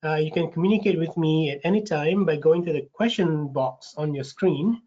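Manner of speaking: ordinary talk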